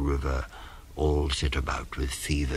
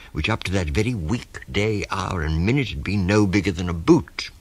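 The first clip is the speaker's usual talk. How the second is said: ordinary delivery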